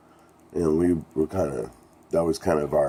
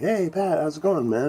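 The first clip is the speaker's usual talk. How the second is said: much higher, chummy greeting; 12 semitones higher than usual. Retold or imitated. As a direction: pitch it way up like a friendly star saying hi. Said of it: "he's like".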